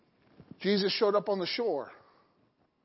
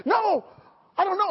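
panicked denial; 9 semitones higher, 4 dB louder than usual. Retold or imitated. Peter denies again.

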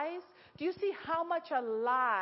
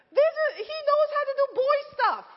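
high, bright laughing delight; excited laughing squeal; 13 semitones higher, 7 dB louder, faster than usual. Retold or imitated. Mother delighting in son's boy stuff, high and laughing. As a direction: gush it out, half laughing.

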